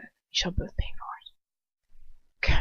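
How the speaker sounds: slow, hissed threat under the breath; a slow, whispered threat to the two of them; menacing whisper. Acted. Character threatens before leaving.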